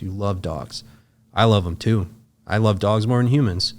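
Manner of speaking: ordinary talk, nothing marked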